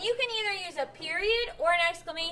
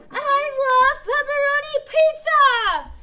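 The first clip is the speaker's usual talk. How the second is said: high, loud and slow, full of excitement; loud, high, gushing delight; excited exclamation; 9 semitones higher, 11 dB louder, slower than usual. Shown as a demonstration. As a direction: gush about it like it's the best thing ever. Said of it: "It expresses strong feelings".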